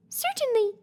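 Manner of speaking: very high, tiny squeaky voice; squeaky mouse queen. A narrator's reading. Mouse queen greets Dorothy in a tiny high squeak. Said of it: "squeaky little voice".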